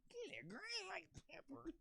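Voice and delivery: whispery, strained cartoon voice; Murder Face. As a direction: squeeze it out with almost no voice. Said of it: "That's like Murder Face".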